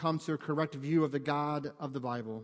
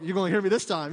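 louder, firm mock threat to the kid; stern parent warning; 7 dB louder, faster than usual. Pastor as parent warning son about the Legos, loud and firm. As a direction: lay down the law, half-joking. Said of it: "a little more encouraging".

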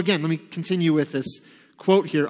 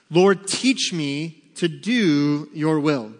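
slow quiet read; 3 dB louder, much slower than usual. Shown as a demonstration. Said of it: "This is the cry of David".

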